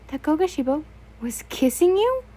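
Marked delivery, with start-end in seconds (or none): 1.5-2.2 much higher and louder, a startled question; gasping realization of the kiss; shocked surprise. A narrator's reading. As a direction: blurt it out in disbelief. Sudden kiss twist in story.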